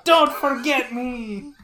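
loud, high, drawn-out cry; mock battle yell. Soldier enemy calling out in battle, drawn-out mock yell. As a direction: yell it slowly like a hammy game soldier. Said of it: "they would just yell".

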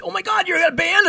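excited coworker. Retold or imitated. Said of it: "she goes".

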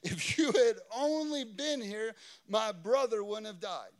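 loud, high cry of reproach; Mary's lament. Acted. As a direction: fling the complaint at the Lord. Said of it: "she says".

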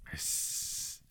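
voiceless hiss; upset hiss. A narrator's reading. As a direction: hiss in frustration. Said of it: "said Gollum, more upset".

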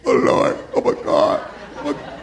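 mock-overwhelmed, unvoiced crying whisper; hammed-up weepy whisper; acted tearful awe. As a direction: choke it out as if overcome by blessing. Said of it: "you cry when you get a".

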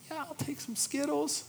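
high, light granny voice; granny accepting candy from grandson; grandma answering sweetly. Retold or imitated. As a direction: play grandma humoring a toddler. Voicing grandma answering toddler.

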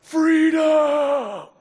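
long, high, loud battle cry. Retold or imitated. Dying William Wallace's last cry, acted shout. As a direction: bellow it out and hold the first vowel. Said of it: "and he cries out".

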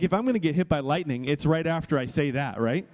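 ordinary delivery

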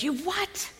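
soft, rising disbelief; incredulous husband. Retold or imitated. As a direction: voice a stunned husband, pitch climbing.

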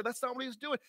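ordinary talk, nothing marked

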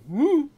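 loud, high "woo"; whoop before a swing. Acted. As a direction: whoop as you wind up to swing. Character hauls off to swing hammer.

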